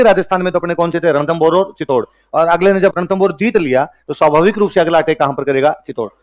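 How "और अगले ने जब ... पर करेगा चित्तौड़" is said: ordinary talk, nothing marked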